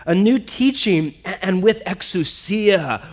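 astonished crowd's reaction, read aloud; amazed. A narrator's reading. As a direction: ask slowly in wonder. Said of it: "the people were all so amazed".

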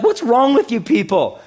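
high, loud mock outrage; incredulous. Reader of Exodus to ungrateful Israel, incredulous.

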